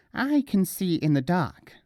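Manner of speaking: boasting. Acted. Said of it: "boasted Nasruddin".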